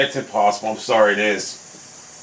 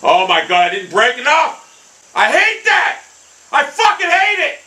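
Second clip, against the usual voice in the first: high, rising exasperated complaint; frustrated outburst; 10 semitones higher, slower than usual. Real, not acted. Gamer ranting at the green after a bad putt, exasperated. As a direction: vent at the green. Putt doesn't break enough.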